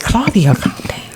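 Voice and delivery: whispered, half-joking calm down; hushed plea. Retold or imitated. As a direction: hush her like a friend who got carried away. Telling feisty AI assistant to relax.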